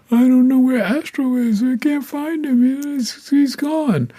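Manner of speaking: high, wailing mock sob; crying like a baby. Friend phoning in tears about her lost dog, high mock wail. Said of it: "she called me up crying like a baby".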